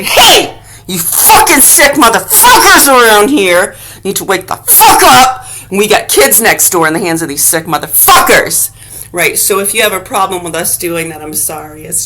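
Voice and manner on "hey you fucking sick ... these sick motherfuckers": louder furious yell at the neighbours; angry shout. Retold or imitated. Telling sick neighbours to wake up, shouting. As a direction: call them out at full volume. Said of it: "And you gotta say".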